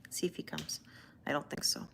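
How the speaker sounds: whisper. Real, not acted. Waiting for the shy cat.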